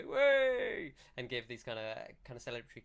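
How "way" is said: high, loud drawn-out cheer; crowd cheer. Acted. Crowd hearing the heroes have returned, loud celebratory cheer. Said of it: "you hear the crowd go".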